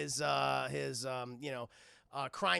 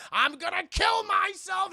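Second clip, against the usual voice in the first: much higher, loud declared yell; defiant shout; 13 semitones higher, 8 dB louder than usual. Retold or imitated. Bruce shouting at the Hatter, defiant yell. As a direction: shout it like a dare.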